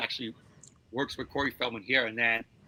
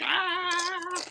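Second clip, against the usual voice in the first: high yelp. Retold or imitated. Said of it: "going crazy".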